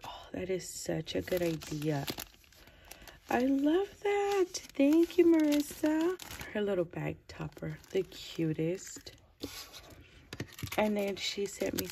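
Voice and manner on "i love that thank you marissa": high, loud delighted gushing; squealing with gratitude; excited thanks. Real, not acted. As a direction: gush with real delight, pitched way up. Thanking sender for gift.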